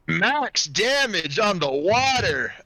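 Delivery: thrilled. Real, not acted. GM thrilled by max damage roll.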